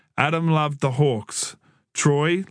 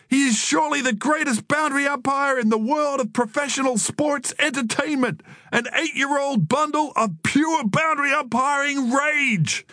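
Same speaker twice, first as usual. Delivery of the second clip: very high, frenzied American wrestling commentary; hyped-up ringside voice, pitched right up; American commentator; 13 semitones higher than usual. A narrator's reading. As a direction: call the fight like an overexcited commentator. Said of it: "in his American commentator's voice".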